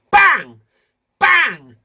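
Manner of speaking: loud high bang bang; loud banging sounds. Acted. Imitating the hammer striking to stop the headache, loud. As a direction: hit each bang like a hammer.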